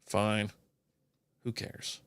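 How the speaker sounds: breathy whispered grumble under his breath; whispered aside. Real, not acted. Vlogger mutters to himself about nobody caring, whispered. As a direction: let it slip out as a defeated whisper.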